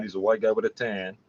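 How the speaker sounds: ordinary talk, nothing marked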